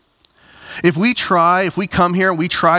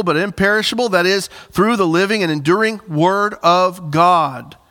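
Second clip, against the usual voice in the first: raised, measured reading of the verse; scripture reading; 3 semitones higher than usual. Acted. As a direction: read the Bible passage to the church. Reads scripture aloud.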